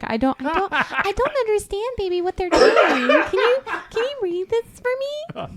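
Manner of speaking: very high, playfully helpless plea; wheedling wife. Retold or imitated. Wife begging husband to read a passage, high mock-innocent wheedle. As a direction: coax him in a put-on high little voice.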